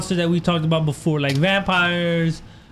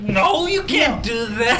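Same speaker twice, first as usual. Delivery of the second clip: slow dismissive; 3 semitones higher, much slower than usual. Retold or imitated. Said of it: "he's like".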